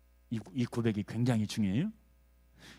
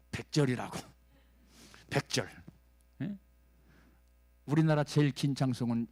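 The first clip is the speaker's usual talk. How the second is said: usual speaking voice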